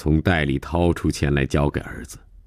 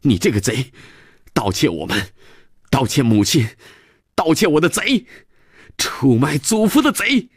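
high-pitched outburst climbing with each phrase; huffy angry shout; 8 semitones higher than usual. Acted. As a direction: hurl accusations, pitch rising to a peak. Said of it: "气哼哼的又喊". (气哼哼地喊)

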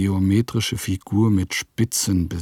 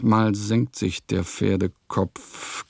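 ordinary talk, nothing marked

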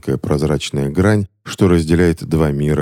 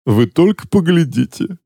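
much higher, mocking delight; mock admiration; 12 semitones higher than usual. A narrator's reading. Jailer Joseph mocks his imprisoned brother, gushing mockery. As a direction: pretend to be dazzled by a small trick. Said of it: "восхитился Джозеф".

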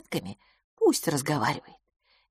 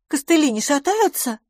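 worried questioning; 9 semitones higher than usual. A narrator's reading. Worried friend checks her legs.

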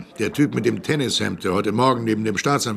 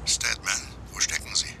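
hushed radio whisper; 4 semitones lower, 9 dB quieter than usual. Acted. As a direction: keep it under your breath. Sheriff flüstert am Funk. (Flüstern)

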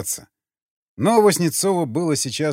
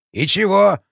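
put-on puzzled old man; 6 semitones higher, 5 dB louder than usual. A narrator's reading.

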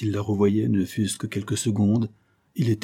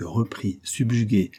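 usual speaking voice

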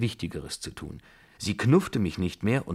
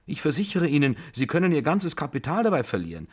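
high, loud, urgent protest; agitated warning; alarmed pleading; 7 semitones higher, 5 dB louder than usual. A narrator's reading. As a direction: beg her to see reason. Narrator pleading against reckless bet.

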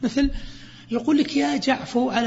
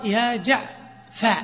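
"يا جعفى" said slowly, the final vowel held long; slow explanatory demo of "يا جعفى"; slow demo form; much slower than usual. Shown as a demonstration. المعلم يشرح للطلاب "يا جعفى" ببطء. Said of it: "لك أن تقول".

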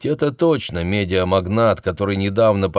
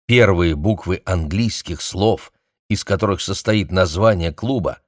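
ordinary delivery, nothing marked

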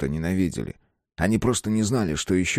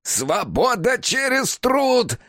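high, very slow hysterical shriek; much slower than usual. Acted. Ward shrieking back at Amy, hysterical and high. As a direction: shriek it like a madman losing control. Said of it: "истерично завизжал Ворд".

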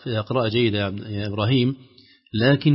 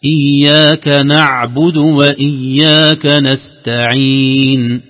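slow, louder chant; recitation model; 6 dB louder, much slower than usual. Shown as a demonstration. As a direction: recite slowly and fully for the student. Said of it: "model again for student". (ترتيل بطيء بصوت مرتفع)

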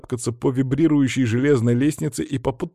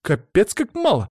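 high-pitched complaint; joining the protest; 9 semitones higher, 3 dB louder than usual. A narrator's reading. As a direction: chime in indignantly, pitched up. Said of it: "присоединилась к ним Стефания".

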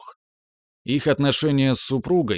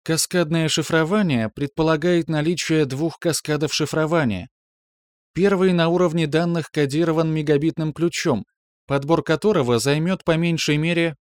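higher, level computer voice; calm metallic voice; 5 semitones higher than usual. Acted. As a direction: object calmly like a machine. System keeps explaining.

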